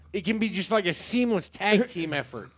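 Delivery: ordinary talk, nothing marked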